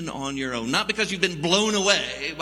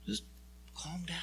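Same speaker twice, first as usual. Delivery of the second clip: hushed, soothing whisper; calm him under your breath; 15 dB quieter than usual. Acted. God settling anxious Moses, soft whisper.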